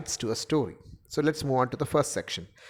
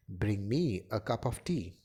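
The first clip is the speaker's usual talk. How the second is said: lower, softer falling "Bring me a cup of tea"; varied intonation; 5 semitones lower, 6 dB quieter than usual. Shown as a demonstration. Lecturer showing different tonalities, low and soft "Bring me a cup of tea". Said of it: "pronounced in different tonalities".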